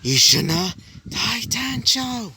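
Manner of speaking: high, slow battle cry in a strange voice; screaming out. Acted. Izuku screams out his attack name as a battle cry. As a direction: call out the attack name like an anime hero. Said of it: "he would scream out".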